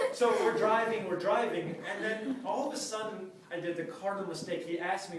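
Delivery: normal talking voice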